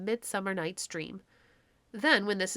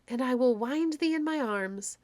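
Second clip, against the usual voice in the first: higher, lightly voiced loving queen; tender fairy queen; 4 semitones higher than usual. A narrator's reading. Titania to the sleepy clown, tender lightly voiced croon. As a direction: croon it as you take him in your arms.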